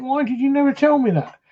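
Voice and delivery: pitched high with a touch of outrage; indignant disbelief. Retold or imitated. Guard confronting colleague about the hidden ghost, high and shocked. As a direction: demand it, shocked nobody warned you. Guard learns of unseen danger.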